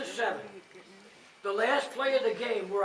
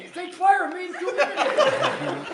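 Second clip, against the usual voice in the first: laughing; 9 semitones higher, 7 dB louder, slower than usual. Real, not acted. Laughing while retelling the remark.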